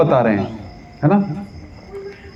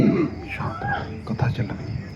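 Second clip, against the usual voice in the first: slow, barely audible whisper; unruffled hush; 12 dB quieter, slower than usual. Acted. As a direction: whisper it calmly, absorbed in the discourse. बुरी खबर पर शांत श्रोता. (धीरे, शांत फुसफुसाहट में)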